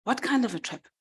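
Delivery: sudden curious alarm, lightly played; piqued pig. Pig pricks up his ears at the news, mildly surprised. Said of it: "The pig raises his ears".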